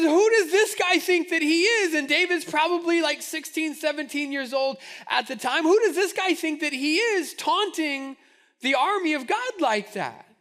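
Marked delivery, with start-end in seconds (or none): 0.0-1.9 indignant outburst. Retold or imitated. Indignant young David outraged. 5.6-10.3 indignant outburst. Retold or imitated. David repeats his outrage.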